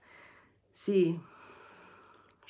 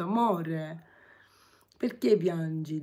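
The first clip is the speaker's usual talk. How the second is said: ordinary delivery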